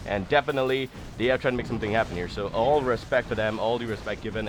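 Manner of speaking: normal talking voice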